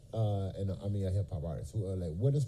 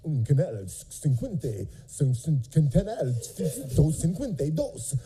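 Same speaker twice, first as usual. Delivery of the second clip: Spanish TV announcer; 4 semitones higher than usual. Retold or imitated. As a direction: imitate a Spanish channel announcer. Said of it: "You know".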